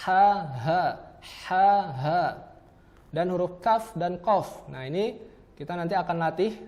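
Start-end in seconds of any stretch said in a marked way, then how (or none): 0.1-2.2 breathy "Ha" against a throaty "ha"; throat-position demo; two h sounds. Shown as a demonstration. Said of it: "tenggorokan bagian bawah".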